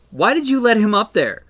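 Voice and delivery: high, loud acted demand; Tanya. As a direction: imitate an exasperated mother's scolding question. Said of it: "And Tanya goes".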